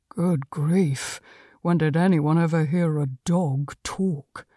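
a hint of disdainful cat; scornful Conrad the cat. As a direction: scoff quietly as a talking cat. Cat scoffs at talking dog.

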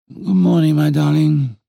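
exaggeratedly sleepy murmur; drowsy, just-woken greeting. A narrator's reading. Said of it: "murmured in an exaggeratedly sleepy voice".

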